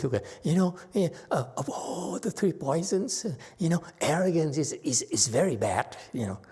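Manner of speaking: lightly acted roundabout rebuke; indirect scolding. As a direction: scold without addressing anyone directly. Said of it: "He will not look at you in the eyes".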